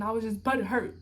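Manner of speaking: normal talking voice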